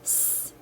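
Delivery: hissing snake s; long unvoiced hiss like a snake; drawn-out s like a snake. Shown as a demonstration. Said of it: "S sounds like the snake".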